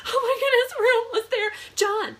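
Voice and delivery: overwhelmed half-sobbing laugh at the state sign; tearful hysterical laugh, high and loud. Retold or imitated. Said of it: "while in hysterics".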